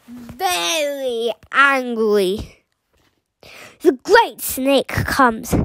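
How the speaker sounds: ordinary delivery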